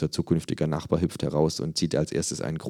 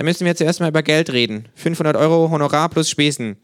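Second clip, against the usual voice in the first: much higher, louder eager kangaroo; 10 semitones higher, 6 dB louder than usual. Acted. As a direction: sell it like a gadget nerd. Känguru voiced by narrator.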